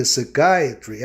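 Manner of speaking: "psychiatry" slowly, stressed on the "eye" sound. Shown as a demonstration. Teacher pointing out the rule in the word, slow "psychiatry" stressed on KAI. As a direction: draw out "psychiatry" as suh-KAI-uh-tree. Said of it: "o I especialmente ou excepcionalmente soa".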